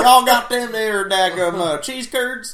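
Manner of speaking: high, loud, slow Southern twang; Southern accent. Acted. Co-host asking after cheese curds, exaggerated Southern accent. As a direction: ham up a thick Southern accent. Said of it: "much stronger Southern accents".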